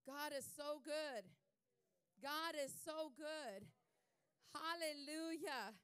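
slow, loud declaration of praise; drawn-out, emphatic pulpit praise; preacher's praise. Real, not acted. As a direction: proclaim it slowly, letting each phrase land.

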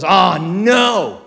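loud, drawn-out groan of dismay; mock-horrified outburst, loud and stretched. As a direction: blurt it out far too loudly. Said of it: "that loud".

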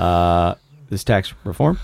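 low, hesitant presidential delivery; bad impression of a president, low and halting; Barack Obama. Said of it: "here's my really bad Obama".

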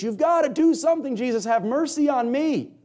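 high desperate begging; pleading cry. Acted. Beggar crying out to Jesus, high pleading cry. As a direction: plead with him, voice straining high. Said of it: "You can just hear it in his voice".

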